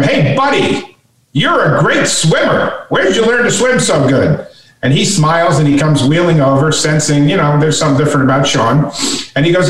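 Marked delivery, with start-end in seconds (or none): 0.0-4.5 higher-pitched imitation of a boy calling out; mock yell. Sean calling to a swimmer in the pool, mock yell. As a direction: call it out high, without real volume. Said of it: "Sean yells at him".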